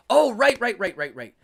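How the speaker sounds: high, loud "oh", then quick rights; sudden realization. Real, not acted. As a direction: it clicks.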